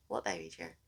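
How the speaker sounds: a lightly voiced dazed man; blank confused Josh. Friend imitating confused Josh, low dazed and soft.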